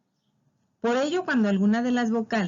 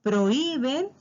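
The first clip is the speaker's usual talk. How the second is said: PROHÍBEN raised, with the Í stressed; accented vowel; 5 semitones higher, slower than usual. Shown as a demonstration. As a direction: lean high on the Í in prohíben. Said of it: "todas las palabras que lleven acento".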